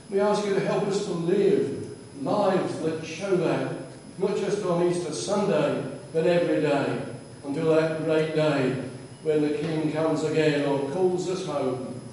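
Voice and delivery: normal talking voice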